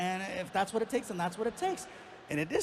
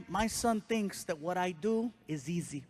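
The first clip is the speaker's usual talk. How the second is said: ordinary talk, nothing marked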